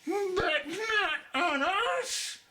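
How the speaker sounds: very high, slow, crying out in fear; frightened oysters crying. A narrator's reading. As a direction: wail it, small and terrified. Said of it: "the oysters cried".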